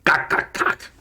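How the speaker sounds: mock goblin language; harsh nonsense syllables called to other goblins; Goblinoid jabber. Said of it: "this voice yell something in Goblinoid".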